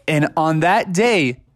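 ordinary delivery

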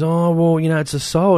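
high, lilting mock old-lady voice. Mocking the old lady who says the duck has a soul, high and sweet. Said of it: "And then she's".

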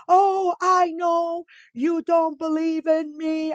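"Oh, I know you don't believe in me" whined high, loud and slow; hammed-up wounded wife, stretched out; mock dramatic woman. Talking about women who turn arguments around, mock dramatic whine. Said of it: "they do drama".

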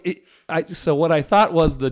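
usual speaking voice

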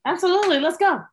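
high, eager "Absolutely"; eager yes. Real, not acted. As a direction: jump in with gusto. Eager agreement.

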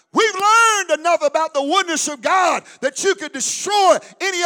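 loud, high preaching, building to shouts; fiery pulpit shout; full-force preaching. Acted. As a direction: preach it, rising to a roar.

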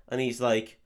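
usual speaking voice

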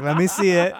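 insist, cracking up; laughing insistence. Retold or imitated. Superman insisting on seeing Batman's hand, laughing.

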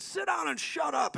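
high, sharp rebuke; commanding shout. Retold or imitated. Prophet rebuking the possessed woman, high commanding shout. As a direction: order the demon down.

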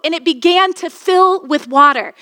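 normal talking voice

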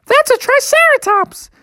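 very high squeaky little bear; excited Sister Bear. Acted. Sister Bear naming the dinosaur, excited. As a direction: pipe it up in falsetto.

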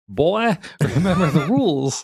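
stern video-game father voice, laughing; Kratos. Acted. Co-host sitting the boy down, mock Kratos scolding. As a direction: put on a commanding character voice, then crack up. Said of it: "In my best Kratos voice".

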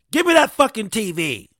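loud, high mock grab for a TV; mock outburst. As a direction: shout it like a greedy shopper at a sale. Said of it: "mocking struggling shoppers".

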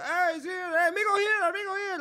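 put-on high, sing-song Mexican-accented greeting. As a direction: call out like the friendly Mexican drive-thru guys. Drive-thru guys greeting him.

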